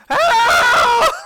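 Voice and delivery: very high, loud, long scream; piercing, drawn-out mock SOS cry; screamed distress call. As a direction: scream for help at full voice. It's just screaming.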